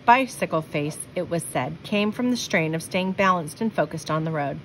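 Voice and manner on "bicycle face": ordinary delivery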